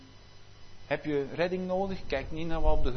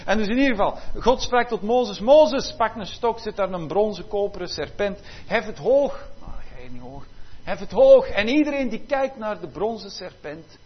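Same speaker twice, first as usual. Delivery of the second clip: loud preaching; 4 semitones higher, 6 dB louder than usual. Acted. God's command retold, preached loudly.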